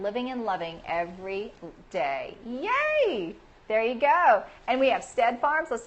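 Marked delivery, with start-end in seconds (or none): 2.5-3.3 cheering. Real, not acted. Host celebrating a viewer's introduction, high cheer. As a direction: let out a high, drawn-out little cheer.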